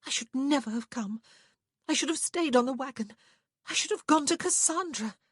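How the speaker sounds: high, climbing distress; frantic regret, pitch going up; distraught. A narrator's reading. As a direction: lament it in rising panic. Panicking woman regrets coming along.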